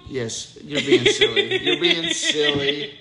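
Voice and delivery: high laughing tease. Real, not acted. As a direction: giggle through it, playful. Dad teasing child, laughing.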